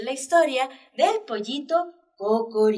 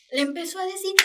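ordinary delivery, nothing marked

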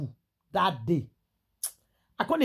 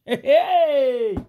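high, drawn-out whoop of amused disbelief; loud exclamation; 6 semitones higher, 7 dB louder than usual. Real, not acted. Vlogger reacts to the gossip just told, loud amused exclamation. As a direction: cry out loud and high, stretching the last word.

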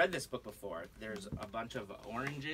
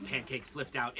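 ordinary delivery, nothing marked

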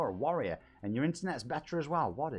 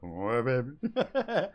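slow, drawn-out crooner; lightly put-on soul-lover voice; 3 dB louder, much slower than usual. Streamer joking about the singer's effect, slow crooning impression.

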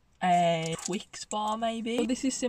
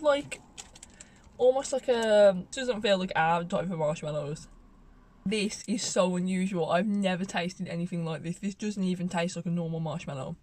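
talking between bites. Real, not acted. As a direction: mouth partly full. Seen 3: holds marshmallow, bites at -1.0 and 4.9, talks between.

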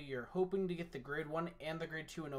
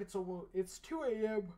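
normal talking voice

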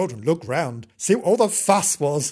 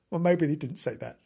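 usual speaking voice

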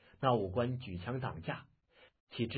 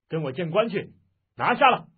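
much louder and higher, a barked command; shouted order; 6 semitones higher, 13 dB louder than usual. A narrator's reading. Officer orders his men to seize the hero, loud shout. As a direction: order the arrest at full volume. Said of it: "叫道".

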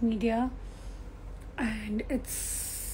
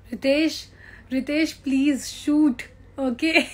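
louder, higher call for attention; 4 semitones higher, 6 dB louder, much slower than usual. Real, not acted. Calling out to Ritesh to please shoot during the live, raised voice. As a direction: call out the name, raised and insistent.

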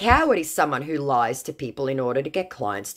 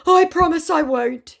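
much higher, louder whiny sorry voice; mock pleading apology; 7 dB louder than usual. Scammer grovels to narrator for forgiveness, high whiny voice.

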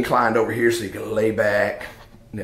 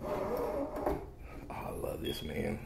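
a hushed whisper of delight; whispered awe; 6 semitones lower, 17 dB quieter, much slower than usual. Real, not acted. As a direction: breathe it out as if not to spoil it. Host admires the kitchen faucet.

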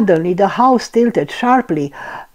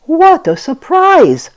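surprised; 10 semitones higher, 6 dB louder than usual. A narrator's reading. Narrator exclaims surprise.

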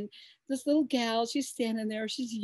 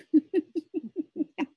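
embarrassed laugh; 10 semitones higher, 4 dB louder than usual. Real, not acted. Laughing at her own crying.

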